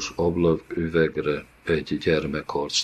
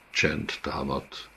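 ordinary delivery

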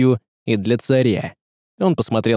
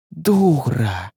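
higher, sharper repeat of the scolding; hissed insult; 4 semitones higher than usual. A narrator's reading. Woman scolding the tsaritsa, hissing insult. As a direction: hiss the insult, pitched up. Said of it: "зашипела".

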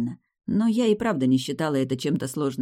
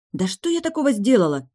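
higher-pitched indignant protest; 6 semitones higher, 4 dB louder than usual. A narrator's reading. Student scolded by the rector protests, indignant. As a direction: protest your innocence with rising indignation. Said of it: "возмутилась я".

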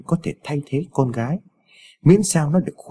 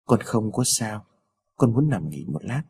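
slow, soft, lower and strained; weakly reassuring, slow and hushed; forced weak smile; 4 semitones lower, 5 dB quieter than usual. A narrator's reading. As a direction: put on a brave face through pain. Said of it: "Niệm Thư gượng cười đáp".

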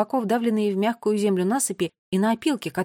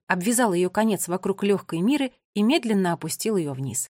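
ordinary delivery, nothing marked

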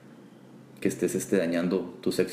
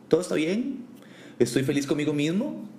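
high, singsong mock-cheerful quote; mock positivity; 5 semitones higher than usual. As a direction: imitate someone insisting all is fine, pitched up. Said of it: "no le gusta mostrar vulnerabilidad". (imitando optimismo fingido, voz aguda)

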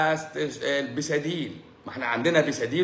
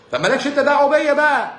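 a much higher, louder outburst; defiant shout; 11 semitones higher, 6 dB louder than usual. Acted. Comedian yelling at critics, defiant shout. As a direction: throw it back at the critics, loud and defiant.

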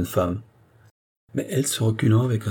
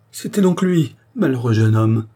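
grieved cry; 3 semitones higher, 5 dB louder than usual. A narrator's reading. Andrée learning of the young man's fate, grieved cry. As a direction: cry out in sorrowful realisation. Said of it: "s'écria André".

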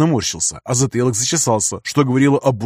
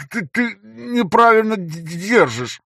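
high, stammering robotic voice; distorted robotic stutter; 9 semitones higher, much slower than usual. A narrator's reading. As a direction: stutter through a distorted mask. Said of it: "искажённым голосом, напоминающим роботизированный".

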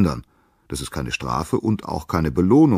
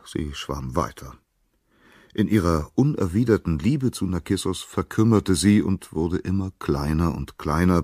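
plain reading voice

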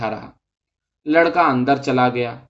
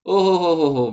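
mock laughing; 3 semitones higher than usual. A narrator's reading. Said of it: "ٹھٹھا مار کر ہنسنے لگا".

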